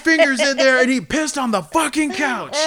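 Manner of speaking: high, loud outraged yelling; angry shout. Retold or imitated. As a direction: rant it in disbelief. Angry friend accusing, retold.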